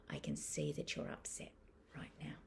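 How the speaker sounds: put-on softly name the child's upset; soft reassurance. Shown as a demonstration. Parent gently acknowledges upset child, hushed and low. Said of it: "noticing and naming for our child".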